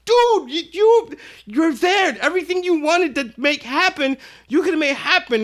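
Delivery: an incredulous friend shouting his disbelief; exasperated yell. Retold or imitated. Retold scolding of friend Skip.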